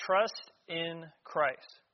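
"Trust in Christ" said very slowly, each word weighed; slow stress on trust. Shown as a demonstration. Preacher to congregation, slow weighty stress on "Trust". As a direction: lean hard on "Trust", drawn out. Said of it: "Key word".

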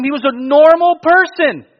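high, drawn-out astonishment; retold amazement. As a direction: stretch it out high and loud, amazed for him. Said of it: "it genuinely surprised him".

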